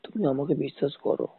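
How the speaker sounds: normal talking voice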